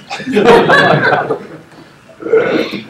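high, loud burst of real laughter. Real, not acted. Laughs at own story.

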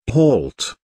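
mildly commanding order to halt; a firm but unraised command. A narrator's reading. Uncle orders the giant to halt, mildly commanding. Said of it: "he commanded".

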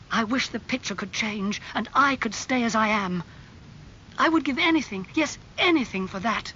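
angry. A narrator's reading. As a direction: plead against fate with mild storybook anger. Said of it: "his face red and angry".

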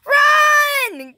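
high, drawn-out yell; high-pitched alarm call; urgent shout. Pretend. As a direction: holler it to start a chase. Urging on the chase.

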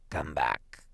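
put-on low, gentle old husband; tender low-voiced coaxing. A narrator's reading. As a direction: call her back softly and tenderly. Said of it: "he said tenderly".

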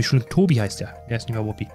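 normal talking voice

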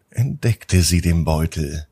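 plain reading voice

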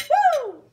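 high, loud joyful whoop; a loud, delighted cheer of joy; excited whoop. Real, not acted. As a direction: let out a big happy woo. Excited cheer after bite.

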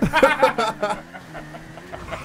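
high burst of laughter; laughing. Real, not acted. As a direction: laugh it off in disbelief. Laughs after shooting.

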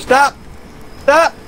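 calling out stop. Real, not acted. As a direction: snap out a quick stop.

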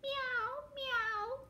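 very high, drawn-out meows; cat meowing. Acted. Reader plays the cat for children, high meowing. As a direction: mew like a little kitten. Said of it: "cried the cat".

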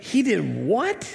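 drawn-out, high, incredulous reaction. Acted. Preacher voicing Esau's reaction to the stolen blessing, incredulous. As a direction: act out stunned disbelief, stretching it out.

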